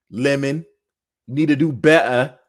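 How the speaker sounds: imitating Lemon's put-on accent, a touch fast; lightly mocking accent voice calling out; half-hearted imitation of Lemon's accent; mock accent impression. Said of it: "Not better with that accent".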